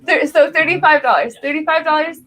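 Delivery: calling out bids to the room; auctioneer call. Acted. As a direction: ask for the next bid like an auctioneer.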